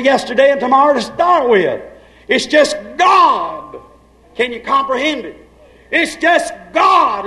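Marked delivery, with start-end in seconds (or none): 2.3-3.8 slow, ringing shouted declaration. Acted. Preacher shouting to congregation, slow and strong. 5.9-7.3 slow, higher shouted proclamation. Acted. Preacher crying out to his church, higher and slow.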